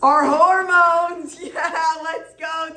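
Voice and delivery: loud, high, drawn-out shout of triumph; whooping teacher praise; excited cheer. Real, not acted. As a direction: celebrate the student's answer big. Cheering a correct answer.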